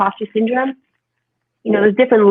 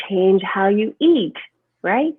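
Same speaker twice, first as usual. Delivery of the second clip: ordinary talk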